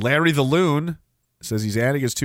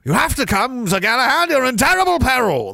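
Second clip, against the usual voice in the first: high, loud mock-heroic urgency; alarmed rescuing knight; 10 semitones higher, 5 dB louder than usual. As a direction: cry out a warning like a hammed-up knight. Acting out Monty Python scene.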